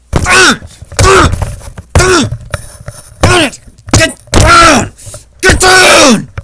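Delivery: high, loud barked command; hammed-up screaming parent; shouting order. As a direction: yell at the kid to get down. Yelling at children.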